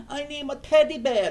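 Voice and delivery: put-on high, loud baby-like plea; mock needy whine. Mocking people needing comfort animals.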